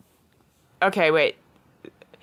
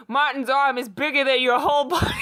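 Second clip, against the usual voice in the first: excited; 8 semitones higher than usual. A narrator's reading. Excited narration, shouting.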